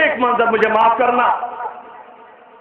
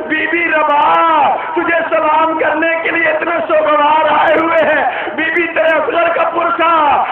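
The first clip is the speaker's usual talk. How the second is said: raised mourning cry; full-force majlis lament; 6 semitones higher than usual. Acted. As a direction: call out to Bibi Rabab in grief. Addresses Bibi Rabab, mourners.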